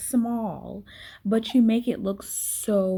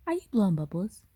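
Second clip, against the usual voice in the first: usual speaking voice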